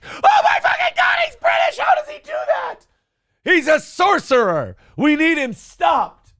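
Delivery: high-pitched screaming panic, hammed-up; hysterical mock outrage. Americans discovering an actor is British, hammed-up hysteria. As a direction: shriek as if exposing a sorcerer, drawing out the end.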